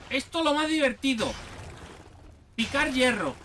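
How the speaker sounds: sarcastic fake excitement about mining iron; ironic enthusiasm. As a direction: sell the boring chore as the best fun, tongue in cheek. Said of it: "No se ha notado mi ironía".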